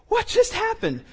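high-pitched stunned question; dazed surprise. Retold or imitated. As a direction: ask it in shock after the crash. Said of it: "they're like".

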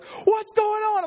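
very high, drawn-out mock panic; wife in overload. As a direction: mimic a frantic wife, stretching the words. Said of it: "she drives into overload".

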